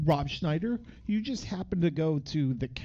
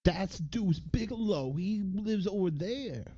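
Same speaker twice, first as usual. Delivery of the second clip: mock kid voice; 5 semitones higher, slower than usual. Mock voicing kid in movie.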